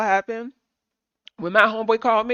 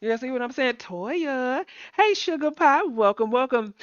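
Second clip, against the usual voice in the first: ordinary delivery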